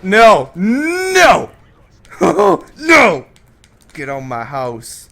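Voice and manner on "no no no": high, loud cries of no; loud despairing no. Real, not acted. Gamer getting killed in the match, loud wailing no. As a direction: wail no as it falls apart.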